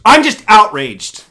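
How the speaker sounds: loud, high hammed-up indignation; mocking outraged outcry; mock outrage. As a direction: imitate someone outraged, drawn out. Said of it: "people are outraged".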